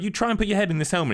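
high, rapid mock-indignant command; hammed-up boss losing patience; exasperated order. Retold boss ordering his guard.

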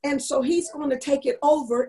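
usual speaking voice

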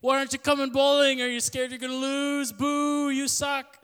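loud, high-pitched jeering; heckling yell. Retold or imitated. Staff member heckling a colleague skipping bowling, mock yelling. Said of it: "I was, like, yelling at them".

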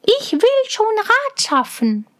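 beruhigendes Mädchen. A narrator's reading. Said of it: "sagte Schneeweißchen".

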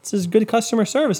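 high, chirpy mock-friendly voice; clueless cheery self. To the waving guy, a high chirpy clueless greeting. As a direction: play yourself as a cheerful idiot waving back. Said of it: "I'm like".

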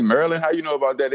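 high-pitched, puzzled question; retold surprise. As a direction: ask it as if caught off guard. Retells own surprise at stranger's guess.

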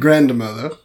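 loud mock-grand address through laughter; mock formal. Former formal grandkid echoing the tease, mock formal. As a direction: echo the stuffy name loudly, amused. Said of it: "I think that's so formal".

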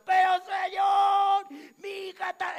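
high, loud, stretched-out protest; pleading shout. Retold or imitated. Mother insisting to the Lord about her daughter, pleading shout. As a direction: cry out against the refusal. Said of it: "Porque viene gritando".

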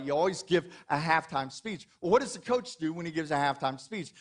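normal talking voice

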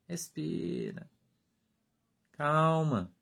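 Respira breathed soft and slow, almost gone; hushed calming. Shown as a demonstration. Pastor soothing a brother about to explode, soft slow Respira. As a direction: let Respira fade into a hush. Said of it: "Eu falo, Irmão, respira".

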